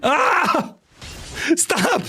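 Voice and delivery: overwhelmed by the flood; high startled outcry. Real, not acted. Streamer swamped by a thousand redemptions, high panicked squeals.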